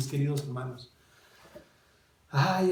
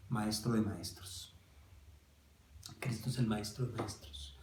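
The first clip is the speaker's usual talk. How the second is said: hushed reverence; 4 semitones lower, 9 dB quieter than usual. Acted. Awed quiet reverence.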